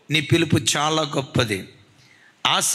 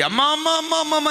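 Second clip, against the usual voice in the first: raised, high cries of pain; crying out; 9 semitones higher, faster than usual. Retold or imitated. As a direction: cry out for his wife, higher. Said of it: "అని అరిచాడట".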